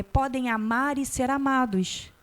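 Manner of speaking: usual speaking voice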